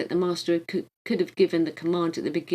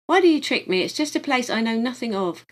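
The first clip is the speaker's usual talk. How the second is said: sharp, louder and higher angry demand; furious; 6 semitones higher, 6 dB louder than usual. A narrator's reading. Grieving man lashing out at his guide, angry. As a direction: hurl the accusation at him. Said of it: "he burst out furiously".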